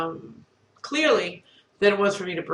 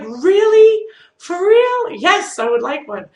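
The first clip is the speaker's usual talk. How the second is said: much higher and louder, squealing delight; thrilled disbelief; 10 semitones higher, 10 dB louder, slower than usual. Retold or imitated. Thirsty patient offered water by the nurse, squealing with delight. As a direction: burst out like she can't believe her luck.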